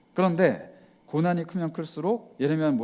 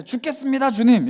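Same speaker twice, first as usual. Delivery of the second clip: high, loud groaning plea; louder "주님" pushed out in distress; anguished cry; 8 semitones higher, 7 dB louder than usual. Retold or imitated. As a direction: cry out that you're dying. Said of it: "내게 고난이 다가왔을 때".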